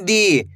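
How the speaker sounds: slow "दी" with a long ee; drawn-out long ee. Shown as a demonstration. Teacher gives learners an example, stretched "दी". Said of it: "खींच के पढ़ोगे".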